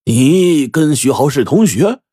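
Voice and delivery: a touch of amazed disbelief; slow, startled "you're classmates?"; surprised. Acted. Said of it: "显得很惊讶".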